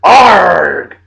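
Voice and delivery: loud pirate arr; pirate growl. Pretend. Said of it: "Talk Like a Pirate Day".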